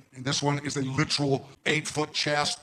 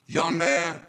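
mild stern call; 3 semitones higher, 4 dB louder than usual. Retold or imitated. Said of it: "That was volume four".

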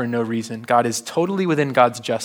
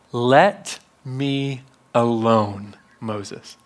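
slow, weighty command; much slower than usual. Acted. God telling Moses to leave him be, slow and weighty. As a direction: space out each word, let the name fall away.

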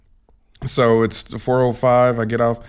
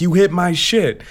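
retold yell; 7 semitones higher than usual. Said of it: "I'm just yelling".

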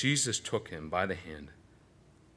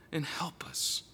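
whispered plea; 6 semitones higher, 8 dB quieter, slower than usual. Acted. Father's plea fades.

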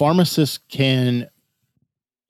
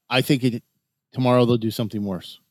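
ordinary talk

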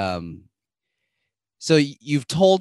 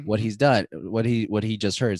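normal talking voice

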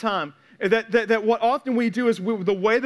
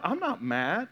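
mock denial; 6 dB quieter than usual. Acting out denial in counseling.

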